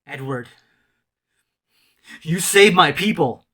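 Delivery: slow, weighty address building to loud; God's solemn voice. Retold or imitated. God calls a man by name, slow commanding voice. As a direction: pause after the name, then proclaim it forcefully. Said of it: "His voice went from the voice down".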